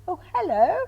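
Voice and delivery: high, lilting mock-polite hello; imitated greeting back. Circle greets the refined newcomer, imitated high-pitched hello. Group replies to refined lady.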